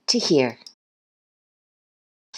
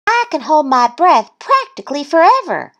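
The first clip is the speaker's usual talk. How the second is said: high little-duck brag; boasting; 11 semitones higher, 4 dB louder than usual. A narrator's reading. Thistle bragging to Goose, high boast. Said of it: "boasted Thistle".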